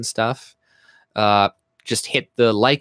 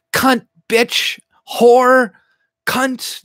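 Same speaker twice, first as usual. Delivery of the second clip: high-pitched, punched-out swear words; demonstrated swearing; 12 semitones higher, 6 dB louder, much slower than usual. Shown as a demonstration. Streamer showing chat how they swear, high and punchy. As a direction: spit out each curse high and loud. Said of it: "that's how I swear generally".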